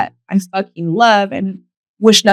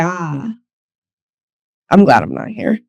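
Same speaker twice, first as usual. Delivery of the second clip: relieved sigh; 5 semitones lower than usual. Retold or imitated. Relieved thought revisiting old club.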